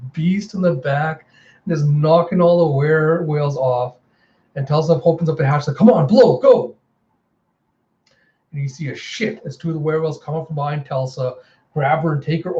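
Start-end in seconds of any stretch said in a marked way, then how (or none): 5.8-6.7 high, loud hurrying call; urgent shout. Acted. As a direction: hustle them through the hatch. Urging escape through the hatch.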